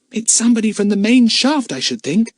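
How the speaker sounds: put-on high-pitched boy's voice; boy character, urgent. A narrator's reading. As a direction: voice an alert young lad, pitched well up.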